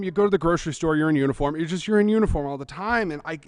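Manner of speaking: ordinary talk, nothing marked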